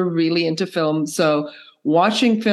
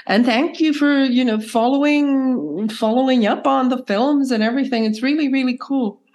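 hoarse from a cold; croaky, cold-roughened voice; 5 semitones higher than usual. Real, not acted. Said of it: "sorry for this croaky voice".

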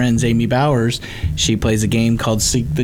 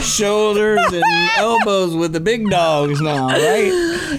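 giddy brag; 10 semitones higher, slower than usual. Real, not acted. Joking brag.

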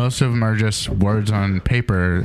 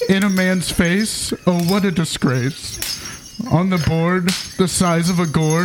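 poem recital; 6 semitones higher than usual. Acted. Comic reading a Christmas poem to co-hosts, mock-solemn recital. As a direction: recite it like a sacred holiday verse.

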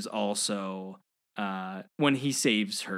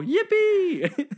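very high, loud whoop; mock gleeful cheer; 5 dB louder than usual. Buzz running after the ball, high gleeful whoop. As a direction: squeal it like a thrilled kid. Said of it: "you hear him go".

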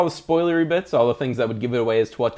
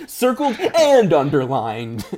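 laughing; 7 semitones higher, 3 dB louder, slower than usual. Real, not acted. Laughing while joking about circled.

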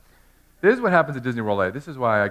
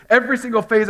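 much higher and louder, building to a peak; rising preacher shout; 10 semitones higher, 8 dB louder, faster than usual. Real, not acted. Pastor rising to full voice before his congregation. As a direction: hit it hard from the pulpit.

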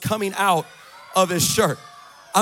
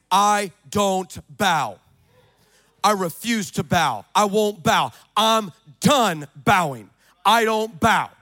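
slow, louder, firm "I don't bow"; defiant declaration; 7 dB louder, slower than usual. Shown as a demonstration. Preacher giving the congregation a defiant vow, slow and firm. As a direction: plant each word, refusing. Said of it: "number one is".

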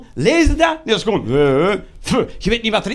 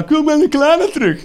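mocking lawyer; 6 semitones higher than usual. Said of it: "Electrabel met achttien van die haaien van advocaat".

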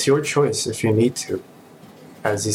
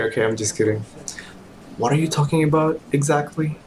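normal talking voice

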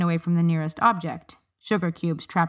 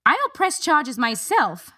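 higher, slow and defiant; 9 semitones higher, slower than usual. A narrator's reading. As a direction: throw it back at him firmly. Harriet counters in argument.

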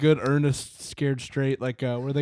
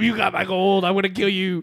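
higher menacing leprechaun growl; 4 semitones higher than usual. Retold or imitated. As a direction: threaten like a small evil goblin. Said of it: "He's like".

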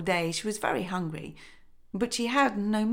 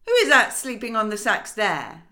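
gruff husband; 4 semitones higher, 6 dB louder than usual. A narrator's reading.

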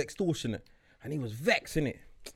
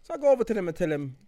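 normal talking voice

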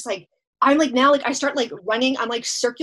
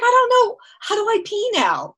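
much higher, louder, baffled; high, panicked; 11 semitones higher, 4 dB louder than usual. Retold or imitated. Tripping woman asking her friend how to pee, high panicked squeak. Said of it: "I was like".

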